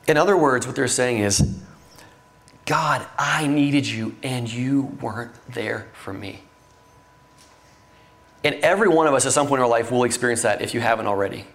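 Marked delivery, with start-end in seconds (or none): 2.7-6.4 slow, understated lament to God; grieving reproach. Acted. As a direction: voice a hurt prayer, drawing the words out. Voicing the sisters' grieving reproach to God.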